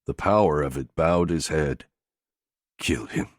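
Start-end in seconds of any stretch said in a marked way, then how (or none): 2.8-3.3 soft low hush; whispered. A narrator's reading. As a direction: breathe it out softly. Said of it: "he whispered".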